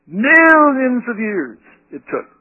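high, loud and slow, a pompous mock voice of the evolutionist. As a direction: drag out Millions as if it were a magic answer. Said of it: "answered by the unregenerate mind like this".

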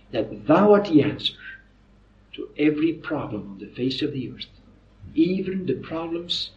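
low, soft, reverent prayer with pauses. Real, not acted. Preacher asking God to guide them tonight, hushed prayer. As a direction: pray softly, pausing between phrases.

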